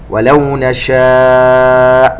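slow, elongated tajweed chanting; Quran recitation. Shown as a demonstration. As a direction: recite it slowly and melodically. Recitation demo. (تلاوة مجودة بطيئة)

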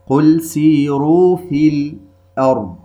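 slow melodic tajweed recitation; Quran recitation. Shown as a demonstration. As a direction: chant the verse slowly with tajweed.

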